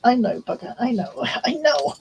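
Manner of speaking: usual speaking voice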